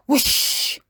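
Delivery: breathy whoosh of a gust; whooshing wind. A narrator's reading. Narrator imitates the wind, a breathy whoosh. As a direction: blow it out like wind.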